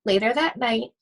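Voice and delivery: plain reading voice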